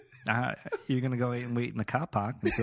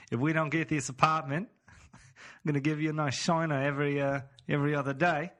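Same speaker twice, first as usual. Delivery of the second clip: mock threat in an Aussie accent, a bit higher; mean Australian; 4 semitones higher than usual. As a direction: menace jokingly as an Australian, half laughing. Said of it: "Like a really mean Australian".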